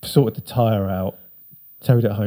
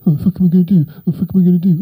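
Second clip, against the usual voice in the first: higher, louder stoned panic; mock panic; 7 semitones higher, 6 dB louder, much faster than usual. Stranded stoned mates panicking, higher louder. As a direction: flap about with no plan.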